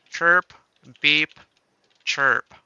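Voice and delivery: normal talking voice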